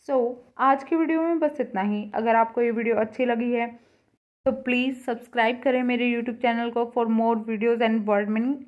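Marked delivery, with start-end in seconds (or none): none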